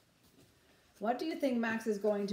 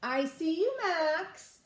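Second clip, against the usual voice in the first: higher teasing sing-song; sing-song sister; 7 semitones higher, much slower than usual. A narrator's reading. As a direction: pretend to spot him. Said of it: "said Ruby".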